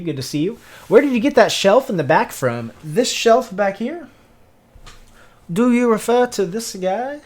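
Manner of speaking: usual speaking voice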